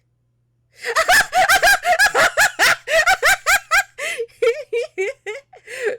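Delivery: high, loud uncontrollable cackling; loud laughing fit. Real, not acted. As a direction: burst into a long, shrieking laugh. Bursts out at game reveal.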